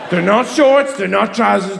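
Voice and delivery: usual speaking voice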